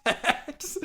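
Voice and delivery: very high, silly radio DJ shout-out; high-pitched hype voice breaking into laughter; mock DJ jingle. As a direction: squeal it like a goofy station ident. Hyped imitation of radio DJ name.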